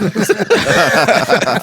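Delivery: big laugh. Real, not acted. Laughing at friend's joke.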